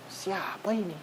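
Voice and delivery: a hushed, awed "who is this?"; hushed amazement. Retold or imitated. Worshipper amazed by the imam's recitation, hushed awe. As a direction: marvel under your breath at the voice.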